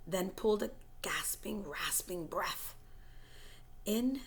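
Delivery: hushed breathy whisper, holding tension; suspenseful whispered storytelling; whispered suspense narration. A narrator's reading. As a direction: whisper as if the attacker might hear. Tense whispered narration after attack.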